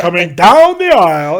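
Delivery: loud ring-announcer swoop on DOWN; Howard Finkel. Shown as a demonstration. Host shows the caller the inflection, loud announcer swoop. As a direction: hit "down" with a big swelling inflection. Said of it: "It's all about the inflection with the Fink".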